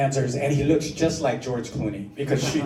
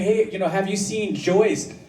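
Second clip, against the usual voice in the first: asking around; 6 semitones higher than usual. Retold or imitated.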